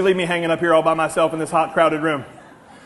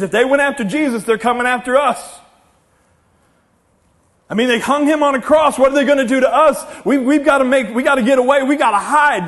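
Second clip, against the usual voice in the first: higher, louder urgent panic; frightened followers scrambling to get away; panicked disciples; 5 semitones higher, 4 dB louder than usual. Acted. Disciples locked in a room fearing they are next, panicked.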